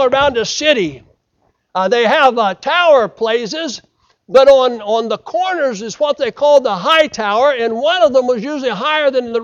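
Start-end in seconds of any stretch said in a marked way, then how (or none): none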